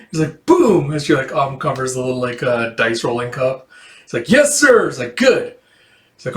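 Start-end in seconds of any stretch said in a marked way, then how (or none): 0.5-0.9 high, punchy burst; loud exclamation. Acted. Dice cup uncovered with a flourish, loud high burst. 4.3-5.5 eager acknowledgement. Acted. Acted exchange of die and gambler.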